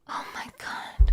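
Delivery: slow, soft, airy whisper; dismayed whisper. Real, not acted. Streamer hearing viewer lost family over coin, dismayed whisper.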